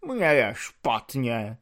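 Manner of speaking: slow, drained plea; despairing voice. Acted. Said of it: "řekl zoufalým hlasem".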